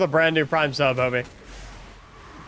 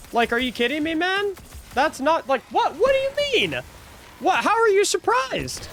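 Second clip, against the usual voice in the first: incredulous disbelief; 10 semitones higher than usual. Real, not acted. Incredulous rant at raider.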